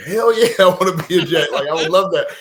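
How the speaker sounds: loud, high, laughing burst of joy; excited yes. Retold or imitated. Drafted player answering the Jets' call, thrilled laughing shout.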